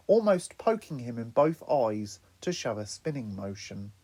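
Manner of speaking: usual speaking voice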